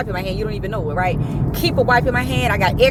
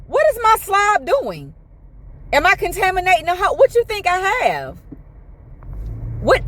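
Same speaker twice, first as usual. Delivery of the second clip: high, indignant, rising outrage; incredulous rant; 6 semitones higher than usual. Retold or imitated. Patient rants at the nurse over slobbing, high and indignant. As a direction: pile up the questions in disbelief.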